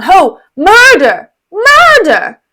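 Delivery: rising loud shouts; raising the alarm. Acted. Iago calling out murder to the town, loud.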